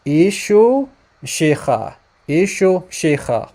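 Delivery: "Ishu Shicha" drawn out as EE-shoo SHEE-kha; Eastern pronunciation. Shown as a demonstration. Teacher echoes the recording for listeners, slow Eastern pronunciation. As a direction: say "Ishu Shicha" slowly with a rasped kh. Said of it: "you hear the name of Jesus being pronounced as".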